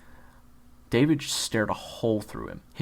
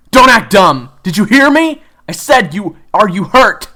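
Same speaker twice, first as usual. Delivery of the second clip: very loud, high scolding shout; angry yell; 11 semitones higher, 19 dB louder than usual. Acted. Kyler yelling at June whether she is hurt, loud scolding. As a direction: snap at her hard.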